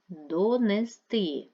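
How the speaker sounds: slow, loud Донести, peaking on final -ти; stress on third syllable. Shown as a demonstration. Teacher counting vowels for pupils, third-syllable stress on Донести. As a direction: spell out Донести, hitting the last syllable. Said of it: "два, три".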